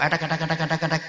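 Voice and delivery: a hint of a wife's angry chatter; mock wife's retort. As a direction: babble edek edek like an arguing wife. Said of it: "Wong wedok".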